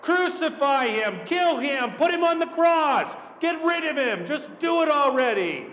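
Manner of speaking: high, forceful mob cries; crowd shouting. Acted. Mob answers Pilate about Jesus, shouting high. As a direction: shout demands like an impatient mob. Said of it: "then someone shouted, and other people shouted".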